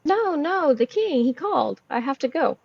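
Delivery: insistent. Acted. Twyla corrects the mushroom man before leaving, insistent. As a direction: brush off the objection and insist.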